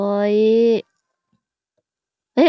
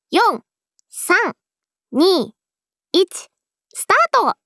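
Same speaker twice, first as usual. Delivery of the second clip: counting down to the start; countdown; 6 semitones higher, slower than usual. Real, not acted. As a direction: call out the countdown, rising at start.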